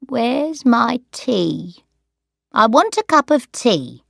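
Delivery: put-on a slow, insistent old woman's demand; demanding old lady. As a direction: press for the tea, a little cross. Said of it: "demanded Sarah".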